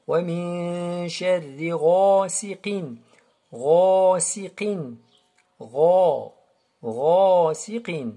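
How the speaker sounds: slow "ghasiqin" with long, heavy "gha"; emphatic recitation. Shown as a demonstration. Teacher models for students, drawn-out emphatic "ghasiqin". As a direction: hum "min", then swell on "ghasiqin". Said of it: "elle est emphatique".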